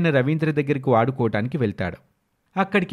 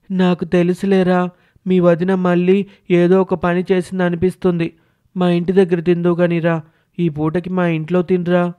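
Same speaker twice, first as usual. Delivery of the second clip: put-on higher-pitched, sympathetic boy character; concerned boy friend; 4 semitones higher, 4 dB louder than usual. Friend inviting hungry boy to eat, sympathetic. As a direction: voice a caring young friend, pitched up.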